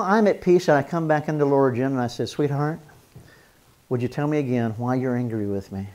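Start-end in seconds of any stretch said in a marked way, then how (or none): 2.3-5.9 soft, tender husband asking; gentle, hushed plea to his wife. Retold or imitated. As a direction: coax her gently to explain. Voicing himself to wife, gentle.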